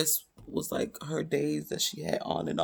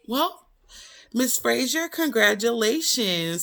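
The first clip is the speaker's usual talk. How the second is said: cheery doctor; 7 semitones higher, 5 dB louder, slower than usual. Retold or imitated. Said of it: "she was like".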